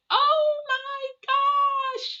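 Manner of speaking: very high, very slow, stretched-out gasp of joy; delighted old aunt. Retold or imitated. Aunt overjoyed her niece called, high drawn-out gasp. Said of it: "she's like".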